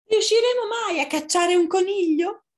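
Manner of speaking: high, loud fox voice; storybook fox's high-pitched question; foxes wondering. Acted. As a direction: ask it high and exasperated, as a fox.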